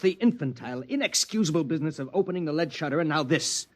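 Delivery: husband berates his wife over the shutter, sharply scolding. Acted.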